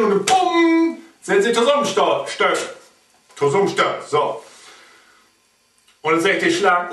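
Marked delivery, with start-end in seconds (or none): none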